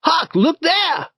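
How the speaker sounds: high, excited shout. A narrator's reading. Tom shouts to Huck on finding the box, high excited shout. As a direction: call out in sudden discovery. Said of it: "Tom went around a corner and shouted".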